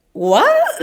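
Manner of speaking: high, startled mock what; shocked what. Tourist stunned when the officer answers in Spanish, high startled what. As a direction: act stunned at the Spanish reply. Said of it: "And I was like".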